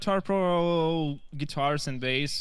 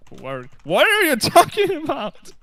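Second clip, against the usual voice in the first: high, loud incredulous outcry; mock disbelief; 11 semitones higher, 6 dB louder than usual. Mimicking everyone's reply to his Russian theory, incredulous. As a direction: imitate everyone's baffled reply, pitched way up.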